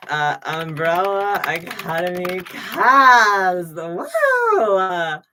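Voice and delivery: slow, drawn-out hype, then a cheer; excited announcement. Real, not acted. Streamer revealing the cast, drawn out and cheering. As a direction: announce it like a game show.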